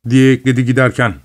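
ordinary delivery

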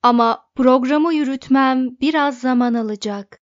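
ordinary delivery

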